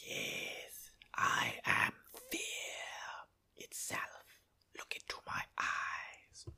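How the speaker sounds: slow, breathy sinister whisper; creepy Myrddraal whisper. Retold or imitated. Rich as a Myrddraal, slow menacing whisper. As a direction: hiss it out like a faceless monster. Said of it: "he'll talk like this".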